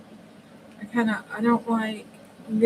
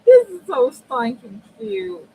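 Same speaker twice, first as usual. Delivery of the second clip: gushing squeal; 11 semitones higher, 9 dB louder than usual. Real, not acted. Gushing over finished page.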